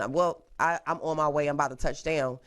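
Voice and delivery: normal talking voice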